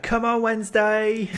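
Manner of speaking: high, loud football fan's cheer; drawn-out shout cheering the team on; Timo Werner shouting. Acted. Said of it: "just Timo Werner shouting".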